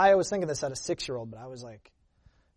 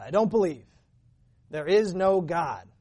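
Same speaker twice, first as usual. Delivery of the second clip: defiant declaration; 3 semitones higher, 6 dB louder than usual. Retold or imitated. Dad renounces God, retold.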